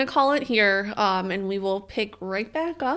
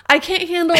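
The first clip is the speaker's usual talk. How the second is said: high, louder squeal of disgust; freaking out; 8 semitones higher, 5 dB louder than usual. Real, not acted. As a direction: panicky and revolted. Freaking out over finger torture.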